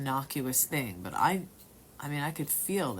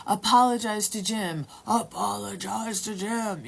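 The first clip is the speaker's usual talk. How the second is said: a spirit commanding the friend, eerie and insistent; scary possessed voice; 6 semitones higher, 6 dB louder than usual. Retold or imitated. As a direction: let the spirit demand it through you. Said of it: "this voice is coming out of me saying".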